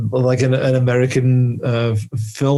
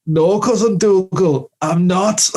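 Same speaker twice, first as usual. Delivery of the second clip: slow, higher-pitched mock hero; weak-sounding Connor; 6 semitones higher, slower than usual. Connor answers Cousin Dougal on the battlefield, mock weak voice. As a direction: drag it out as a feeble Highlander. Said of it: "he's just so weak, weak sounding".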